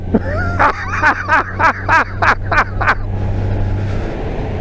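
long, high real laughing fit; hearty laughter. Real, not acted. Storyteller recalling friend fleeing the reunion, long high laughter. Cracks up at the gaffe.

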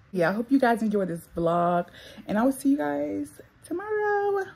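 ordinary talk, nothing marked

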